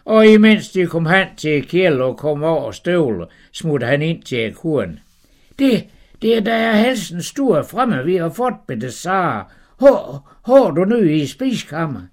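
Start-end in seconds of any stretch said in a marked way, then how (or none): 5.6-12.0 high, hurried and fluttered; flustered husband. Acted. Abraham rushing in to tell Sara, high and flustered. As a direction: stammer out the news in a rush.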